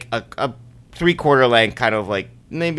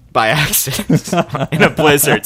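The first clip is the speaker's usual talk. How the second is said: words broken by a laugh; chuckling while telling; 4 semitones higher, 3 dB louder than usual. Real, not acted. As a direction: laugh through the punchline. Laughs through the line.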